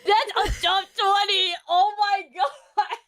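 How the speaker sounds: shrieks the line through loud laughter; cracking up, nearly crying with laughter; laughing shout. Real, not acted. Reacting to a funny line.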